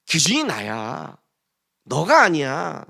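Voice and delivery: very slow, low, weighty God voice; slow divine declaration. Retold or imitated. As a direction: lay down the rule, word by word. Voicing God's declaration. (아주 천천히 선언하는 하나님)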